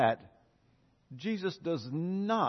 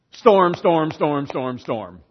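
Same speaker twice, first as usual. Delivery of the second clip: commanding storm; 11 dB louder, slower than usual. Retold or imitated. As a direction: summon the storm, loud, then let it die away. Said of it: "God is like".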